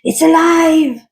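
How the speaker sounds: high, loud, slow mad scientist shriek; triumphant cry. Acted. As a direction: scream it like Frankenstein's maker. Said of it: "cried Doctor Franken".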